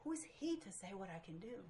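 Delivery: woman quietly realizing her stubbornness, hushed; quiet inner realization. Retold or imitated. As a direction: think it softly to yourself. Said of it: "it dawned on me".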